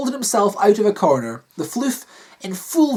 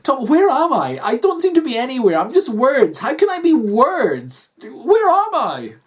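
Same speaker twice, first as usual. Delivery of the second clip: higher-pitched, bewildered woman character; distressed Kathy; 6 semitones higher, 3 dB louder than usual. Acted. Wife calling out to her husband, bewildered. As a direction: plead in a higher voice, lost.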